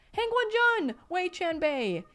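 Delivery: loud, very high joyful call; overjoyed shout. A narrator's reading. Boy calling to his rescuers on the next boat, overjoyed. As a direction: call out with delight across the water. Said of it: "he shouted to them, overjoyed".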